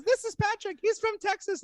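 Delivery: very high mock London accent; Izzy's London accent. Girl introducing herself to a Texan, high London accent. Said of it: "And she goes".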